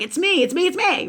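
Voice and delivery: high-pitched exasperated self-blame; frustrated. Retold or imitated. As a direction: climb high in mock exasperation. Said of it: "I've been super frustrated".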